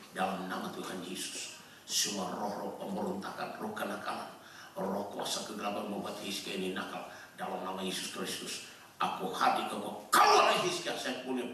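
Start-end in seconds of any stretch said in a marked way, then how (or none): 0.1-10.0 low, soft prayer; hushed, low incantation before the outburst; retold deliverance prayer. Retells praying over his naughty son. 10.1-11.0 sudden very high command; acted high-pitched exorcist outburst; mock exorcism cry. Acts out casting out spirits.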